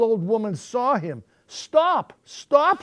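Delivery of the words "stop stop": high, loud call to stop; old woman's high-pitched cry. A narrator's reading. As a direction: call after a runaway. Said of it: "she cried".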